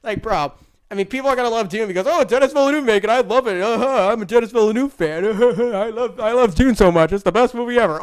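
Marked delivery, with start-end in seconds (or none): 2.1-8.0 mock fan gushing. Mimicking fans again.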